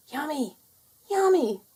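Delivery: high, rising in loudness yummy; little rabbit thrilled by chocolate; delighted. Acted. Bertie smells chocolate.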